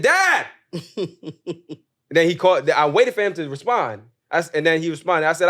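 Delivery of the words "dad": loud high yell of dad. Retold or imitated. Shouting to his dad from the front door, loud yell. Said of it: "So then I yelled it again".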